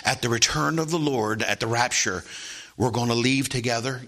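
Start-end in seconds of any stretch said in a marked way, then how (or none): none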